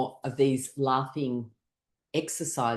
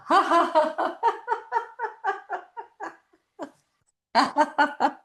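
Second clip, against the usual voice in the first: loud, high staccato laugh; big forced belly-emptying laugh; laughter; 4 dB louder than usual. Shown as a demonstration. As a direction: laugh long and hard on purpose. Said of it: "drink it up with laughter".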